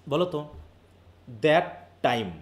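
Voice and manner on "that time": "That", pause, "time", each standing alone; syllable by syllable. Shown as a demonstration. Teacher counting syllables for pupils, "That time" split by a pause. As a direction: separate "That" and "time" as single beats. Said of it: "তাহলে এক একটা syllable না".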